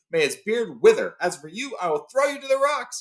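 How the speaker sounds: high rising threat; cried out. Acted. Thorin threatens to throw Bilbo, high angry cry. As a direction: build to a high furious threat. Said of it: "he cried".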